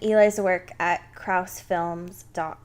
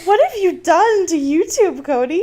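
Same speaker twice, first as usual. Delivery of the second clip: laughing disbelief; 7 semitones higher, 5 dB louder than usual. Real, not acted. Incredulous laughing question.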